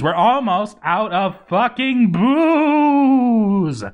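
high, loud, slow cry of outrage; outraged yell. Acted. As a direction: howl it like the world is ending. Outrage at running out of booze.

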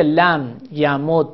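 یمد slowly as ya-mud-da; doubled d in یمد, opening into a; لم یمد, drawn out with final fatha; merged with fatha. Shown as a demonstration.

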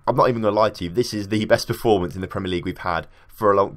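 normal talking voice

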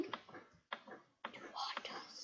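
slow, mock-haunted whisper; drawn-out eerie whisper; spooky whisper. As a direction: whisper it like a playful ghost. Mock spooky warning, ends in whisper.

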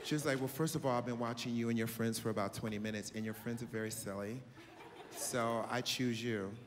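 calm and in total control; grown woman voice. Retold or imitated. Voicing the older woman.

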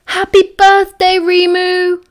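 high, loud, drawn-out birthday cheer; noisy excitement. A narrator's reading. Three boys jump on Remus's bed at dawn, shouting excitedly. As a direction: burst out like excitable teenage boys. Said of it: "noisy, highly excitable teenage boys".

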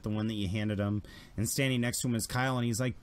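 normal talking voice

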